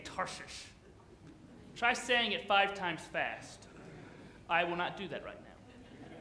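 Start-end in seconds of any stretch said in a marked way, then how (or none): 0.0-0.6 whispered aside. Acted. Odd word said aside.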